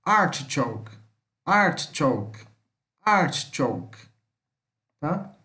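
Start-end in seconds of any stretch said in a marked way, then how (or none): 0.0-4.0 artichoke as "ART-chouk"; pronunciation model. Shown as a demonstration. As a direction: repeat artichoke clearly, pausing between takes. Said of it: "a sua pronúncia é".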